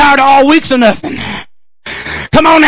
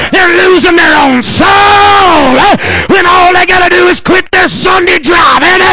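driving chanted sermon cadence with gasped breaths; hard Pentecostal preaching, punctuated with amens; full-force preaching; 5 semitones higher than usual. Real, not acted. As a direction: build into a shouted, drawn-out revival climax.

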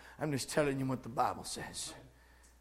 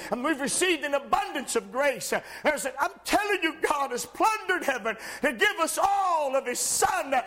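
high, ringing pulpit voice, climbing to a shout; fervent preacher lifting the room; full-force preaching; 10 semitones higher, 5 dB louder than usual. Acted. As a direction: preach it out to the whole church. Preacher rising again.

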